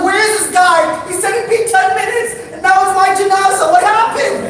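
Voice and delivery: high, loud, frantic complaint; hammed-up terror at being abandoned; mock panic. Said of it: "And I'm freaking out".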